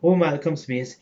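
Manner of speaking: normal talking voice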